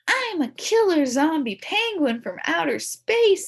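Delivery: loud, high monster brag; proud announcement. Acted. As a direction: boast it to sound scary. Boy announcing scary costume.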